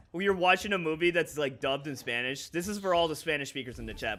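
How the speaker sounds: usual speaking voice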